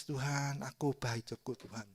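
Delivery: soft plea sinking away; hushed recalled plea to God; retold tearful prayer. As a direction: pray it quietly, as if remembering tears. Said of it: "Saya tuh kucu nangis".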